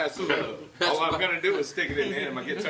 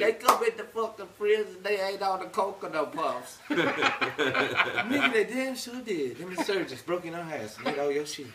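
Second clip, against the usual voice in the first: Katt Williams impression; 3 semitones higher than usual. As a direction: rant like a wound-up stand-up comic. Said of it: "Please do Cat Williams".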